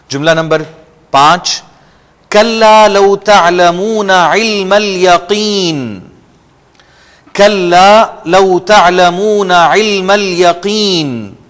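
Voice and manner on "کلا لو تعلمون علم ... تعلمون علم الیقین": slow, higher chanted recitation; Quranic recitation. Shown as a demonstration. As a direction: recite with tajweed, stretching each word. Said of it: "تعلمون کے عین کو underline آپ کریں گے".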